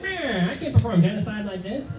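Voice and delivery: high, whiny wiseguy impression; bad Joe Pesci. Burglar hit by tar and feathers complaining, shoddy Pesci impression. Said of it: "It's a really shitty Joe Pesci impression".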